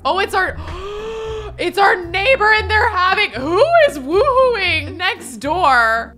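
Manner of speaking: high, loud and drawn out; shocked disbelief. Real, not acted. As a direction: gasp it out in scandalised surprise. Shocked at neighbors' noise.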